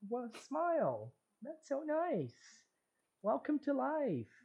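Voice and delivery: high-pitched parody of a park greeting; mock welcome. Parodying the park voice to a co-host, high mock welcome.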